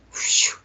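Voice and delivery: airy, voiceless whoosh; imitated whoosh. Storyteller imitates the void suddenly opening, airy whoosh. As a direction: imitate a sudden rush through space. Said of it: "all of a sudden this".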